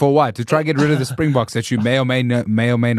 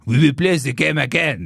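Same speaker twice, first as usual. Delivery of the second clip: mock French accent, pitched up from the first take; hammed-up French-accented English. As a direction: mimic a Frenchman speaking English. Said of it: "play the game again in French".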